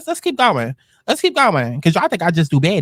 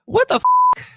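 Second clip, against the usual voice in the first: high, squeaky cut-off what the; shocked squeal; slower than usual. Real, not acted. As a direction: gasp it out in disbelief. Shocked reaction to the clip.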